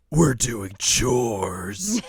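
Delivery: loud, hugely drawn-out declaration; overdramatic. Retold or imitated. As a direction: stretch it out in mock outrage. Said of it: "So dramatic".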